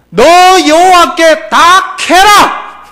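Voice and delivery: slow, loud Gyeongsang 케라 with a falling end; Gyeongsang-dialect command, slow and loud. Shown as a demonstration. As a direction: draw out 너 여호와께 다 케라 like a dialect command. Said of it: "경상도 말과 좀 연관시켰는데".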